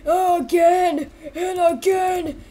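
high, slow, crying wail; hammed-up agonised villain's farewell; dying vow of revenge. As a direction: drag out the threat through mock sobs. Dying character swears revenge.

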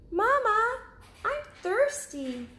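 high, small cub voice; little cub asking. Acted. Cub asking mother about sleeping outside, high little voice. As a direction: ask like a curious little bear cub. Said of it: "and said".

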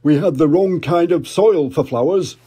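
jolly put-on dad voice; Daddy Pig. Daddy Pig remembering, hearty dad voice. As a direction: chuckle it out as a big daddy pig. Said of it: "said Daddy Pig".

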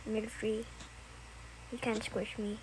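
low, soft monotone; name yourself low and evenly; put-on character voice. Child back in Negative Three role, low. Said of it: "this is just a good voice impression".

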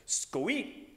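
"Scoeat" said as one quick word, skoh-eet, rising at the end; playful made-up word. Shown as a demonstration. Pastor to congregation before potluck, playful "Scoeat". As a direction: announce "Scoeat" with a laugh and a lift. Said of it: "I learned a new word".

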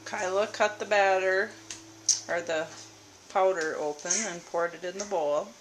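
ordinary talk, nothing marked

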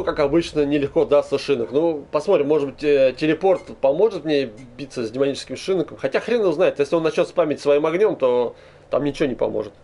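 ordinary delivery

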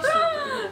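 high-pitched mock crying wail; imitated desperate wail. Hitchhiker bursting into tears at the offer, mock wailing. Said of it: "Ela começou a chorar assim, desesperada".

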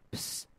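breathy secret psst; whispered psst. A narrator's reading. A stranger calls Daisy quietly with a whispered psst. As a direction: hiss for attention like a spy. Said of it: "whispered the stranger in a deep, mysterious voice".

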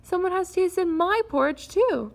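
a mild mother bear's plaint. A narrator's reading. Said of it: "moaned in her soft, mild voice".

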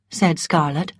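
plain reading voice

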